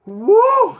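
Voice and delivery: high, loud whoop; excited whoop. Real, not acted. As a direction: let out a big woo. Reacting to onion challenge.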